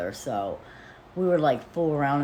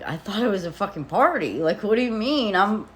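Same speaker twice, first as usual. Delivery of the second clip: retold protest, higher and louder, building; indignant protest; 7 semitones higher, 6 dB louder than usual. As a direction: push pitch and volume up in exasperated self-defence. Retold protest to the blaming general manager.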